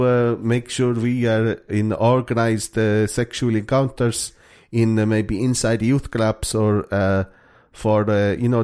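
put-on slow, stumbling Finnish accent. Host voicing Finnish teens on discotheques, slow comic Finnish accent. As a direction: play an earnest Finn hunting for English words. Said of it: "That's a pretty good Finnish accent".

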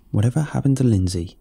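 ordinary delivery, nothing marked

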